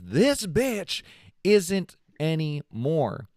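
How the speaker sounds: put-on a sassy girlfriend laying down the law; sassy mic drop. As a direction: drag it out with defiant sass. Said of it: "texted him back saying".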